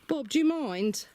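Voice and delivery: exasperated scolding. Real, not acted. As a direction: scold the bird in a high indignant voice. Scolding chicken Bob.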